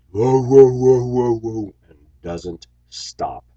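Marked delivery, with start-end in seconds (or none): 0.1-1.7 loud, high barking whoa whoa, fading on the last; imitated dog bark. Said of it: "except to say that when it barks".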